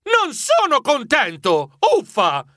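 put-upon, petulant grumble; grumbling protest. Acted. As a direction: complain like a reluctant traveller. Narrator whines about having to leave.

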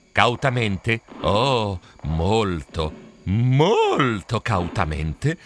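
low, drawn-out storytelling, word by word; cautious creeping. A narrator's reading. Narrator creeping toward the beach, slow wary delivery. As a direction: stretch molto out slowly, warily.

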